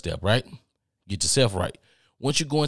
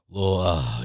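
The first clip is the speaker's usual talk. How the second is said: ordinary talk, nothing marked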